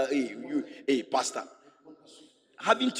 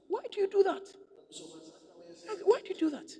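much higher, shifted voice, rebuking wife; scolding pastor; 6 dB quieter, much faster than usual. Retold or imitated. Pastor rebuking the wife, high incredulous scolding. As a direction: scold her in disbelief. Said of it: "So I told the wife".

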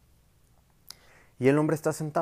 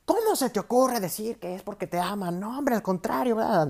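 higher, indignant neighbour voice, only mildly cross; mock angry neighbour; 9 semitones higher than usual. Neighbour scolds the beaten wife, pitched-up mock outrage. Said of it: "le dice la vecina súper enojada".

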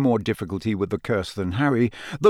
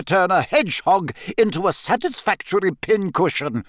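much higher, sharp scolding teacher; stern McGonagall; 9 semitones higher than usual. A narrator's reading. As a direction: put the pupil firmly in place. McGonagall reprimands, very high.

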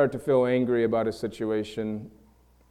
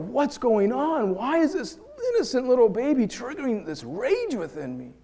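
much higher, strained and half-laughing in disbelief; retold panic, pitched way up with a nervous laugh; panicked self-talk; 10 semitones higher than usual. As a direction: replay the alarm of catching yourself in a rage. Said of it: "And I said to myself".

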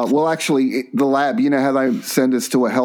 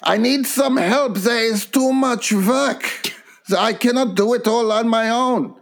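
Gunter; 8 semitones higher than usual. Retold or imitated. Colleague begging the bosses for help, high German-accented whine. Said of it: "Gunter says to the people".

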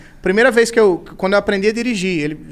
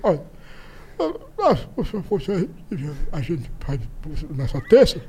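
lightly voiced old grandpa, mumbling, much softer; 3 semitones lower, 6 dB quieter, slower than usual. Grandson imitating senile grandpa's mumbled talk, softer. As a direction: trail off into soft mumbles like a confused old man. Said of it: "Era assim a conversa dele".

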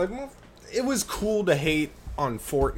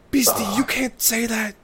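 cartoon character voice; 9 semitones higher than usual. Playing Teen Titans character.

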